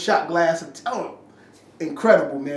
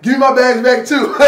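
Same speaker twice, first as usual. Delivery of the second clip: laughing demand; 9 semitones higher, 15 dB louder than usual. Retold or imitated. Friend demands his bags too.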